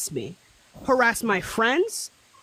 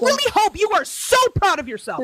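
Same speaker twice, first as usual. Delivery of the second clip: biting sarcasm; 7 semitones higher, faster than usual. Real, not acted. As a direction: throw the pride back at her in anger. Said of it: "you do not need to yell at me".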